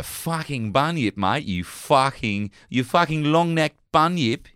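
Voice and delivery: hammed-up Australian swearing; Australian accent. Host riffing on the bunyip, hammed-up Australian accent. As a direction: stretch the curses in mock Australian. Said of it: "you can do the accent".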